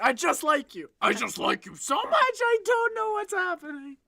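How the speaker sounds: loud confession climbing very high; frantic crush voice. Acted. Girl confessing she likes him, loudly panicking. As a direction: blurt it out, squealing up at the end. Girl whose voice runs away from her.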